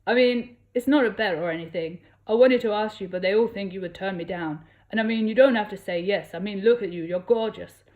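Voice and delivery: a hint of an unplaced accent, keen and loud; Fiero's voice. A narrator's reading. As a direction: talk her into it with a grin. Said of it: "he grinned".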